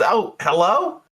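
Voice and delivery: high, surprised phone greeting; bartender answering a call. Acted. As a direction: pitch it high as the bartender picks up. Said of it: "she goes".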